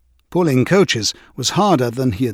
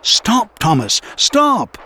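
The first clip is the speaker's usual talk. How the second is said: shouted; 8 semitones higher, much slower than usual. A narrator's reading. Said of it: "Thomas's driver shouted".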